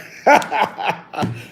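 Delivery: real amused laugh. Real, not acted. Telling viewers how crazy it was, amused laugh. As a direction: crack up after the story.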